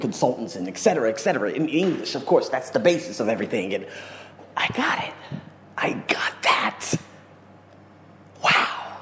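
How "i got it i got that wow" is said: whispered, slow and hushed; awed whisper. Real, not acted. Vlogger realizing his success, awed whisper. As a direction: breathe it out in awe.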